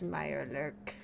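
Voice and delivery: lightly voiced ghoul. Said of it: "That's my ghoul voice".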